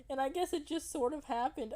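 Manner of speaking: high SpongeBob voice. Acted.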